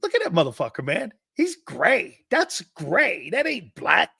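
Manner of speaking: high-pitched mock disbelief; swooping high locker-room teasing voice; Jerome Brown. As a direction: imitate a teammate ribbing someone, pitch leaping up. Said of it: "Jerome looks over at me".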